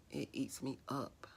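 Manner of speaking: barely voiced, guilt spilling out in a whisper; a whispered admission that it still eats at her; hushed. Real, not acted. Woman confessing to her viewers, in a hushed whisper.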